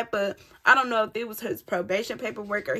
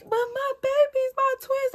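much higher, wailing for her babies; tearful pleading. Retold or imitated. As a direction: imitate herself crying for her twins in the cell. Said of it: "I'm like".

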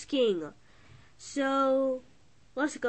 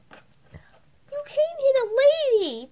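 indignant lady; 10 semitones higher, 5 dB louder than usual. Acted. Talking dog protesting that you can't hit a lady, indignant. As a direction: protest primly in a squeaky put-on voice.

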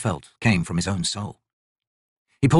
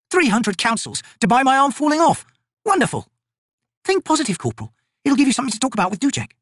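higher, brisk soldier banter; female soldier voices. Acted. Two soldiers bickering over cursed armbands, higher and brisk. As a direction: rib your fellow soldier.

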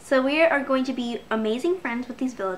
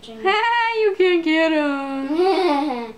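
laughing taunt; 3 semitones higher, 6 dB louder, much slower than usual. Real, not acted.

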